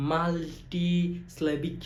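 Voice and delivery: মাল্টিসিলেবিক slow, split মাল, টি, স্লেবিক; spaced-out beats on মাল্টিসিলেবিক; syllable by syllable. Shown as a demonstration. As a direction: pause inside মাল্টিসিলেবিক. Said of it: "ভাগ ভাগ করে উচ্চারণ".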